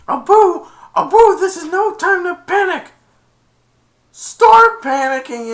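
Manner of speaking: loud high comic panic, slowing at the end; panicked yelling. Retold or imitated. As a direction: scream at your monkey while the lava comes. Aladdin yells at Abu escaping lava.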